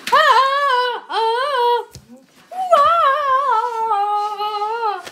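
put-on loud wailing baby cries; mock baby crying. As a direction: wail like a baby. Child voices a crying baby.

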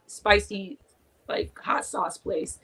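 ordinary delivery

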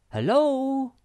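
high, loud call from afar; shout. A narrator's reading. Snufkin calls to Sniff from the top, shouting. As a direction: call down with a long, high hello. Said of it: "shouted Snufkin from the top".

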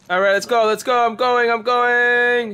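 loud, high hype call; rally the team as you charge; excited shout. Real, not acted. Charging in during a fight.

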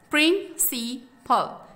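प्रिंसिपल very slowly as प्रिं, सि, पल; syllable by syllable. Shown as a demonstration. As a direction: pause between the three parts of प्रिंसिपल. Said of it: "pronounce करें". (प्रिंसिपल को धीरे-धीरे तीन हिस्सों में बोलें)

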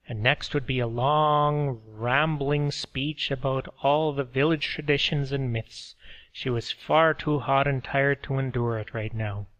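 ordinary delivery, nothing marked

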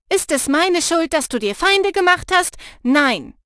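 lauter, höher, „meine“ hart betont; wütend nachäffend. Acted. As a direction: spiegelt ihr den Vorwurf wütend zurück. Raja äfft Lena im Streit nach. (wütend nachäffende Freundin)

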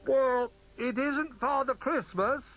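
high, loud, drawn-out call; high storybook voice calling down. A narrator's reading. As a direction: call it slowly from up a chimney. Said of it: "he called".

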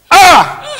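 high, short "ah!"; sudden high cry of despair. Acted. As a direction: cry out in anguish. Despair over the money.

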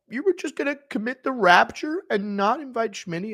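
high offended whine; Schmitty impression. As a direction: squeak it out, left out again. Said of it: "Schmitty impression".

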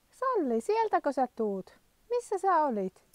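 high, sing-song call to a dog; high-pitched doggy talk, asking where she's been. Real, not acted. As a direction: coax the dog home in a high voice. Calling her dog back.

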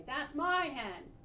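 slow, loud furious claim; angry. A narrator's reading. Furious fisherman chasing.

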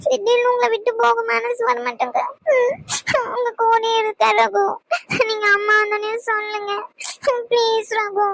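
tearful plea in broken phrases; weepy, reluctant to part; crying. Acted. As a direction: beg him through sobs. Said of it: "madam உட்காந்து அழுதுகிட்டு இருக்கீங்களோ".

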